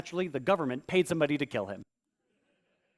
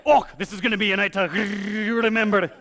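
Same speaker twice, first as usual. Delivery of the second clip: mock excited party girl; 4 semitones higher, 6 dB louder than usual. Mock excited girl going clubbing.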